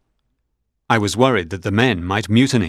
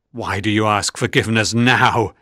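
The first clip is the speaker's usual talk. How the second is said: plain reading voice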